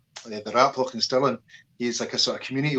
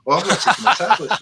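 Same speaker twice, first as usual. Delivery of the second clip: high, louder, laughing through the words; half laugh it as the penny drops; laughing realisation; 7 semitones higher, 6 dB louder than usual. Retold or imitated. Telling his friend about forgotten tablets, high laughing realisation.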